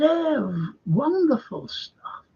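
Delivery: high, drawn-out mock-snob approval; snobbish surgeon. Snob answering David at a reception, high mock-snooty gushing. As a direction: gush slowly in a high, snooty voice.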